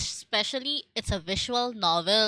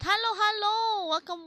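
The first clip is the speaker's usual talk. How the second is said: excited greeting; 11 semitones higher than usual. Real, not acted. Greeting raider.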